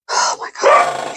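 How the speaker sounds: slow whispered exasperation. Real, not acted. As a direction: breathe it out in a whisper. Exasperated aside.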